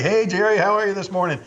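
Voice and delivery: lightly imitated overfriendly greeting; fake buddy greeting. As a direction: greet him like a phoney old pal. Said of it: "acting like he was my buddy".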